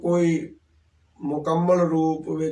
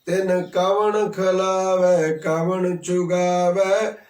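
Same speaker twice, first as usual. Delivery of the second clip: scripture recitation; 3 semitones higher, slower than usual. Acted. Preacher quotes scripture verse.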